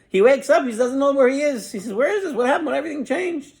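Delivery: high, puzzled voice rising; a confused man waking somewhere strange; bewildered. Retold or imitated. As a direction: sound lost and amazed. Confused waking in new house.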